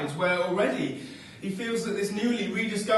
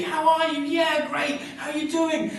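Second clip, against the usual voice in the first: excited catch-up; 8 semitones higher, 3 dB louder than usual. Acted. Excited catch-up phone chat.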